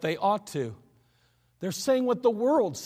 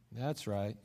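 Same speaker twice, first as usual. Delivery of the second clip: ordinary talk, nothing marked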